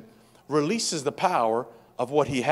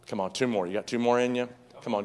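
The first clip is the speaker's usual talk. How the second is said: normal talking voice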